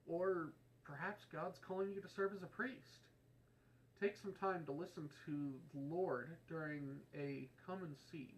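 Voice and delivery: earnest, inviting radio commercial; ad read. Acted. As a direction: call listeners to come and see. Vocation ad read.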